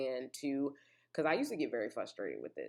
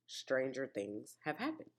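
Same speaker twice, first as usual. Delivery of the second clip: ordinary talk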